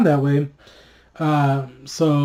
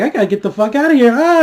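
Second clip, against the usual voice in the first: frantic dragon; 7 semitones higher, 4 dB louder, faster than usual. Retold or imitated. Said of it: "Drogon was like".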